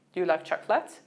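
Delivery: ordinary talk, nothing marked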